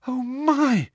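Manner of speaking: high breathy shock; gasping. A narrator's reading. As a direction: gasp in alarm. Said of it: "gasped Hermione".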